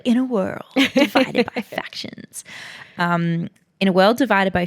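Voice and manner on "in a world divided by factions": higher, dramatic trailer narrator; movie trailer voice. Shown as a demonstration. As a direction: announce it like an epic film trailer. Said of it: "it reads like a, a movie trailer".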